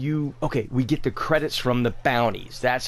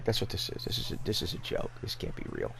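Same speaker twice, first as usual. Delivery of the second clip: breathless whisper, much softer; whispered disbelief; 7 semitones lower, 9 dB quieter, faster than usual. Real, not acted. Player whispering to himself over Ticker's prices. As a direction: mutter to yourself in shock.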